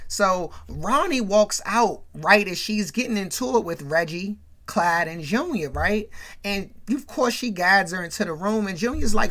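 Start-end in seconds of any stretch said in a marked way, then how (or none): none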